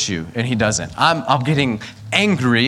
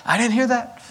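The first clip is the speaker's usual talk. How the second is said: mock denial; 11 semitones higher than usual. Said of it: "And you're like".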